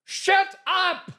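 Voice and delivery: high, loud British shout of shut up; yelled shut up. Retold or imitated. British director yelling shut up at the actor. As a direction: bark it at the actor across the set. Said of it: "And he's saying".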